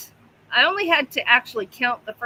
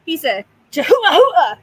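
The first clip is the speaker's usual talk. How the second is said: loud and high, almost shouted; big playful reveal to the dog; excited announcement; 4 semitones higher, 9 dB louder than usual. Real, not acted. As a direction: announce it with a burst of glee.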